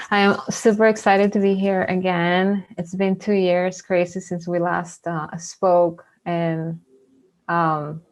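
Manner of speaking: ordinary delivery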